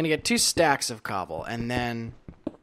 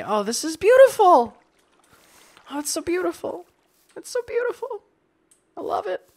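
high, gushing delight; 6 dB louder than usual. Real, not acted. Delighted player gushing over the sunny sky. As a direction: swoon over the sunshine.

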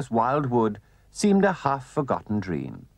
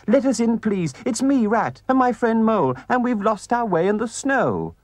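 high, loud pleading plea at the door; high, urgent little Rat voice; Rat crying out; 11 semitones higher, 3 dB louder than usual. A narrator's reading. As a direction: beg to be let in out of the snow. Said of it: "cried the Rat".

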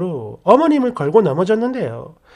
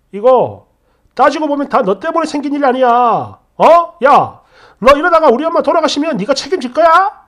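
louder, higher, fast accusation; blaming outburst; 4 semitones higher, 5 dB louder than usual. Retold or imitated. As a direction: push the blame onto her. 남편이 아내를 탓함. (남편이 아내 탓하며 따지듯)

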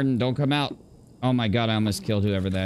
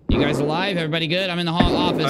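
loud, raised check-in call; 7 semitones higher, 4 dB louder than usual. Real, not acted. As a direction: call out to the squad. Calling out after friendly fire.

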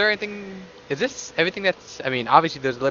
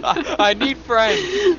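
mock crying; 9 semitones higher, much slower than usual. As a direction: fake sob, high and slow. Mocking friend's line as crying.

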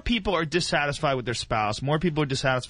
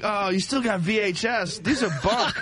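laughing quote; 7 semitones higher than usual. Retold or imitated. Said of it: "I'm like".

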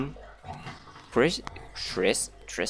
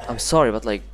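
ordinary delivery

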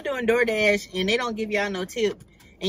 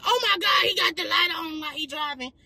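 a touch of a scandalized viewer, higher; mock shocked critic, a bit higher; 6 semitones higher than usual. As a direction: lightly play a shocked critic. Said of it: "for the people that be like".

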